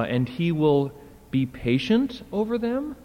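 "patient over them" rising high to the end; puzzled question. Shown as a demonstration. Lecturer trying another awkward translation, puzzled. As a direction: lift "over them?" as if it makes no sense.

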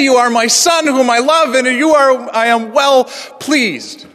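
the text spoken as a joyful declaration; glorious proclamation. Shown as a demonstration. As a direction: proclaim it like a father's blessing. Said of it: "This is a glorious thing to say".

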